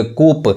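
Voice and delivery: कूप said with a final p, "koop"; wrong pronunciation. Shown as a demonstration. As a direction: sound out the p in कूप. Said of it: "ये पी नहीं पढ़ाएगा".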